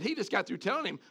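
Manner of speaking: ordinary talk, nothing marked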